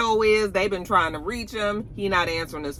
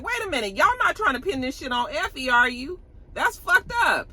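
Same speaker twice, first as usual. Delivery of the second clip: shocked Kane; 8 semitones higher than usual. Retold or imitated. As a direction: gasp it out, stunned. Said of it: "he was like".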